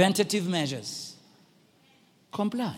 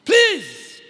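raised-voice appeal; 9 semitones higher, 3 dB louder, slower than usual. Retold or imitated. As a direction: imitate a pilot calling over screaming passengers. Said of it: "raises the volume a little bit".

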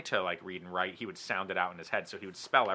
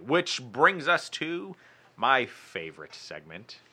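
drawn-out teaser; 4 semitones higher, 5 dB louder, much slower than usual. Acted. Building up to the show segment.

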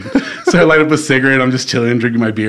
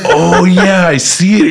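put-on higher, louder goofy drunk voice; mock drunk guitarist; 5 semitones higher, 4 dB louder, much slower than usual. Drunk guitarist shrugs off what he sees, goofy drunk voice. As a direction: play the drunk guy, casually impressed.

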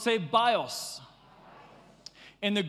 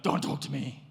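snappy; 7 semitones lower than usual. Acted. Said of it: "you're snappy".

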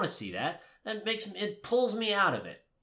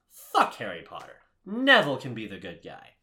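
ordinary talk

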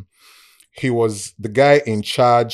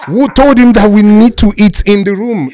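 high, loud imitated protest; mock outraged shout; 10 semitones higher, 14 dB louder than usual. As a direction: shout it as an alarmed workmate, hammed-up. Acted protest of a paranoid workmate.

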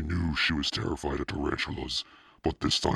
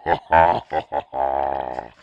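loud, slow mocking laugh; loud slow jeering ha ha; mocking laughter; 9 dB louder than usual. A narrator's reading. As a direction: laugh at her loudly. Everyone laughing at her.

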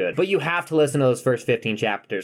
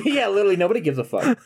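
laughing, pitched way up; 9 semitones higher, faster than usual. Real, not acted. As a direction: laugh through the words. Laughing agreement.

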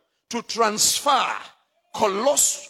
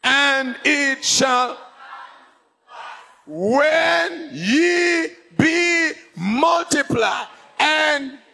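scripture read slowly; 5 semitones higher, much slower than usual. Real, not acted. Preacher to congregation, slow reading. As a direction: stretch every word.

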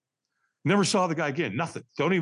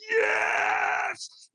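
very high, drawn-out reenacted scream; top-of-the-voice scream. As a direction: let the yes rip, pitched way up. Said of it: "I screamed at the top of my voice".

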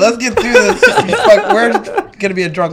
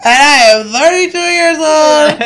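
higher, louder mock crying shout; sobbing shout; 6 semitones higher, 4 dB louder than usual. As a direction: wail it like a reality-show contestant. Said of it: "I remember the".

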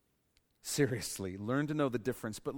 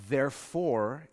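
ordinary delivery

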